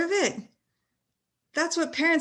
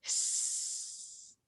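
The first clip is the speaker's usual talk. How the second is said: long unvoiced snake hiss; 14 dB quieter than usual. Shown as a demonstration. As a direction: hiss softly through the teeth. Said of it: "heard you hiss".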